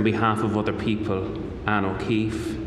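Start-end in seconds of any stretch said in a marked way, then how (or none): none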